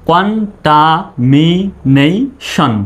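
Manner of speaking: Contamination drawn out syllable by syllable; slow teacher demo. Shown as a demonstration. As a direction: stretch each syllable for the class. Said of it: "ভেঙে ভেঙে demo".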